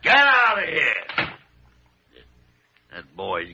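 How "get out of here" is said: barked get out, slow and loud; gruff dismissal. Acted. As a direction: throw him out of the office. Irritated doctor dismissing Jimmy.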